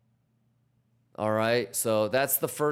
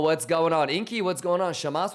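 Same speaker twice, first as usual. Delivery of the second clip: ordinary delivery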